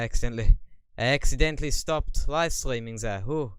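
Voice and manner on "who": ordinary talk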